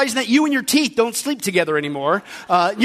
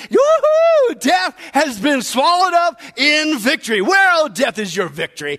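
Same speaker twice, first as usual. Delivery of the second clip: excited; 2 semitones higher, slower than usual. Acted. Preacher voicing Paul getting excited over death's defeat, exultant. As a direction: exult like a thrilled apostle, stretching the big words. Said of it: "you can hear Paul get excited again".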